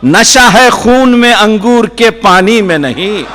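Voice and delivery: high, ringing declaimed verse; poetry recitation. Acted. Poet repeating a verse as listeners cheer, declaiming high. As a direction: lift the line and project it to the hall. Continues verse after applause.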